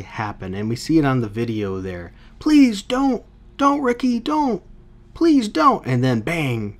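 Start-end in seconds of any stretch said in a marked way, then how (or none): 2.4-5.8 high, pleading mock terror; begging. Reviewer reads the counselor begging Ricky on the video, high and pleading. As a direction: beg him not to shoot.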